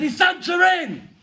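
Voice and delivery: high, loud shouted question; loud call. Acted. Comedian shouting at Santa's door, high and loud.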